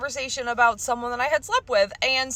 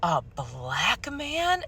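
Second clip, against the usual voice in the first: slow menacing quote; 4 semitones lower, 7 dB quieter, much slower than usual. Retold or imitated. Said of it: "he was like".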